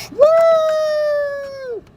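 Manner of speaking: high, loud whooping yell out a car window; a big rowdy high whoop; woo shout. Retold or imitated. Passers-by in a car yell at him, loud whoop. People yelling from passing car.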